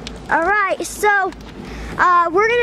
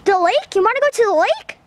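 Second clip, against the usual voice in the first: ordinary delivery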